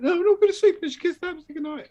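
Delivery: put-on high, fast childish insisting; whiny kids. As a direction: rattle it off like a stubborn little boy. Kids' insistent quote retold.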